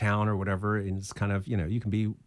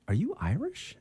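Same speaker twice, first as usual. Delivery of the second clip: astonished question; 10 semitones higher than usual. Retold or imitated. Retold women's astonished question.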